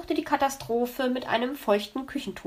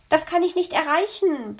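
higher-pitched mild protest; childlike complaint; 6 semitones higher, 4 dB louder than usual. A narrator's reading. Little rabbit complaining to the flute teacher, plaintively. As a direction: complain like a little pupil who can't manage. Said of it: "beschwerte der sich".

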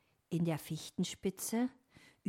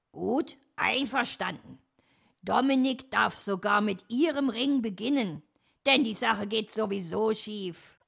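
louder, raised, self-assured concession; magnanimous; 4 semitones higher, 7 dB louder than usual. A narrator's reading. Fox lets rival go first, loudly magnanimous. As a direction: grant it grandly, sure of winning. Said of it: "sagte er großzügig".

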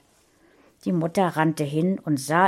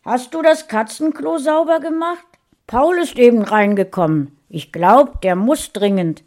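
much higher and louder, calling across the flat; the father calls to his wife from the living room, raised voice; calling from next room; 6 semitones higher, 7 dB louder than usual. A narrator's reading. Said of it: "drang Vaters Stimme aus dem Wohnzimmer".

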